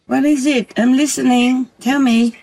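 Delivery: calling out. Real, not acted. Calling out to the fox.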